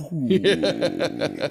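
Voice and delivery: high, drawn-out "whoo"; mock whoop of freedom; relieved whoop. Dad alone in the car, high celebratory whoo. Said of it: "You get in the car, you go".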